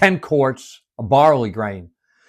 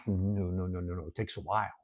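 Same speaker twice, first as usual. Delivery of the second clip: soft reply; 6 semitones lower, 15 dB quieter, faster than usual. Real, not acted. Answering own question softly.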